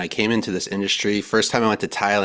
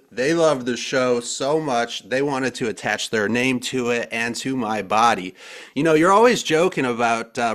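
ordinary delivery

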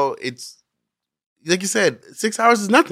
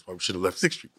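low, softer muttered grumble; passive aggressive grumble; 8 semitones lower, 10 dB quieter than usual. Retold or imitated. Telling the podcast host what he thought, low under-breath grumble. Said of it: "I'm like".

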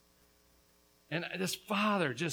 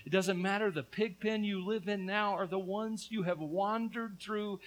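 normal talking voice

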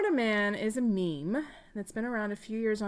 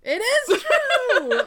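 high, loud and slow through a laugh; laughing reveal; 10 dB louder, much slower than usual. Real, not acted. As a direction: laugh out the answer.

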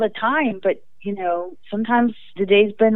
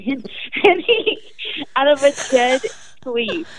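ordinary delivery

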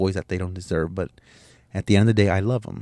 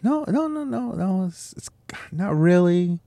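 much higher, squeaky flustered no, no, no; flustered denial; 11 semitones higher than usual. Retold or imitated. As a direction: dodge the question in a panicked high voice. Retold flustered reply to son.

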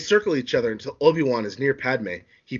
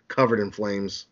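ordinary delivery

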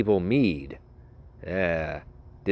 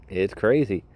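usual speaking voice

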